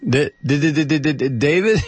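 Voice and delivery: rapid-fire stuttered first sound, then the name; machine-gun D's calling a lost caller; playful stutter call. Pretend.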